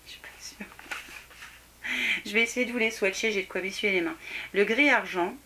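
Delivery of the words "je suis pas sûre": unvoiced whispered aside. Real, not acted. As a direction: breathe it under your breath. Doubtful aside after question.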